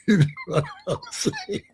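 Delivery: squeaky, high laugh through the words; can barely get it out for laughing; real giggling question to chat. Real, not acted. Cracking up over the story.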